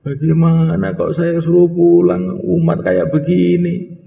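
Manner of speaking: a slow, high, mildly tearful plea; a hint of crying when sent home. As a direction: beg to stay, slowly, near tears. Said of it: "Pulang tuh nangis".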